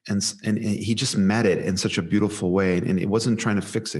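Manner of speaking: ordinary talk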